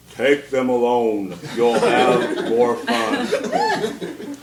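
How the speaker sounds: put-on slow, low, solemn like a wise old mentor; a lightly done Obi-Wan style pronouncement; serious profound voice. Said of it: "in this real serious, profound voice".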